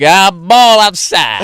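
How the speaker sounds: loud, slow, high umpire call. Pretend. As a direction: bellow it like a hammy umpire. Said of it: "that you'd adopt an umpire voice".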